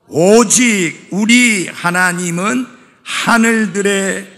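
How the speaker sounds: loud, slow, measured recitation; leading scripture reading. Real, not acted. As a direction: lead the congregation word by word. Leads congregation reading verse aloud.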